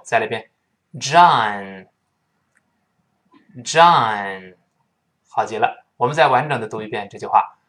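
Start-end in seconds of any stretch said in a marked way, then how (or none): 0.9-4.5 "John" with open ah, closing on n; drawn-out "John", tongue root still; correct American John. Shown as a demonstration. Teacher repeating for learners, long ah and front n. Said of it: "舌根不动".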